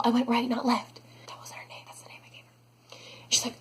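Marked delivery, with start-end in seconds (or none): 0.0-1.0 whispered, exasperated correction; muttered retort. Retold or imitated. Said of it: "And I was like". 1.3-3.0 hushed confiding whisper; whispered aside. Real, not acted. Aside about the nickname.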